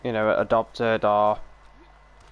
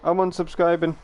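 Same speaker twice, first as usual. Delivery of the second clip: mock offended viewer; 7 semitones higher than usual. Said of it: "He's like".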